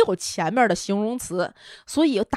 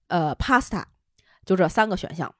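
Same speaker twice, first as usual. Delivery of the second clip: normal talking voice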